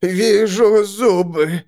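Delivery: male seer voice. A narrator's reading. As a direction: intone it as the man seer. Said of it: "сказал мужчина".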